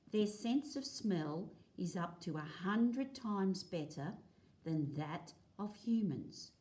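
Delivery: clear, lively read-aloud for a class; reading to children. Acted. Teacher reading a dingo book to the class, lively read-aloud. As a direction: read the page out to the kids, a touch brighter.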